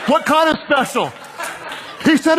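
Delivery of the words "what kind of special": laughing quote. Retold or imitated. Mom asks.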